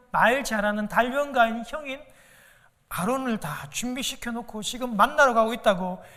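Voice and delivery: ordinary talk, nothing marked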